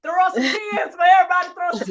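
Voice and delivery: loud, high tearful wail; crying out at full voice; real crying. Real, not acted. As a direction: shout it through tears. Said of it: "we all might as well cry".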